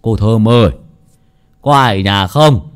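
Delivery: loud call toward the gate. Acted. Old man shouting to Thơm through the gate. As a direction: call out for someone to open up. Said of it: "Ông kêu lên".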